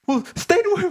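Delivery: high-pitched, loud hammed-up blubbering; exaggerated tearful plea with a laugh; mock crying. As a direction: imitate a boy wailing and stumbling over his words. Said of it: "like, crying in the classroom".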